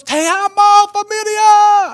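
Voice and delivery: loud, high greeting with drawn-out vowels; exuberant greeting. Retold or imitated. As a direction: call it out big, imitating a joyful pastor. Imitates pastor's exuberant greeting.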